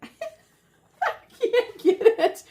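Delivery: high, squeaky laugh through the words. Real, not acted. Struggling to open the gift, laughing. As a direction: give up in helpless laughter.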